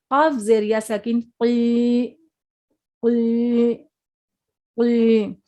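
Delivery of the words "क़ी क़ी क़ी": क़ी with a thick back-of-tongue Q, long ee; full-mouthed heavy qaf. Shown as a demonstration. Teacher drilling heavy letters, thick full-mouthed क़ी. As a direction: fill the mouth and hold क़ी steadily. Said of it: "ये हरूफ मोटे हैं".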